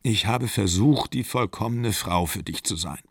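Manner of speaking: ordinary delivery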